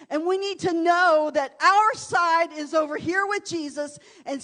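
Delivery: rally the women to push back; preaching at full force. Acted.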